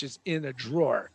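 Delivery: ordinary delivery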